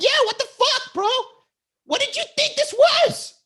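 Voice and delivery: high, loud mock outrage; hammed-up shrieky scolding; imitated yelling tirade. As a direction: mimic her incredulous yelling at the top of your range. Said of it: "yelling tirade".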